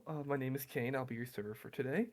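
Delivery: soft-spoken. Acted. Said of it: "It was very soft toned".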